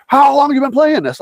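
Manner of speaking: high, incredulous mock buddy; amazed question. Buddies amazed at him.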